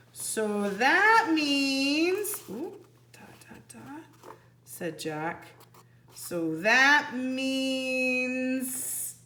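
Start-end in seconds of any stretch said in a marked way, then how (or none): 0.1-4.3 very slow drawn-out start fading to a whispered da da; trailing off. Acted. Jack working out where they are, slow and fading. 6.1-9.1 very slow, stretched words; drawn out. Acted. Annie echoing Jack's thought, slow and suspenseful.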